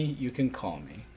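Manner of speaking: normal talking voice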